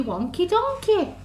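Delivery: usual speaking voice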